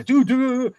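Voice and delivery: high exasperated dude; mock berating. Teammate berating a struggling player, rising exasperation. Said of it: "or say".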